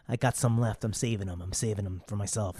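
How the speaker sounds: very low, quiet monotone; small, low voice of a simple-minded inmate; meek prisoner hoarding peanuts. Acted. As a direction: mumble it low and even, guarding your stash. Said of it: "And he's just like".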